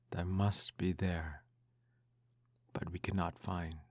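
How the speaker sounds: ordinary delivery, nothing marked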